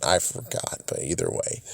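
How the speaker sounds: soft whisper under breath; whispered aside. Real, not acted. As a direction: whisper it to yourself. Mumbled aside while thinking.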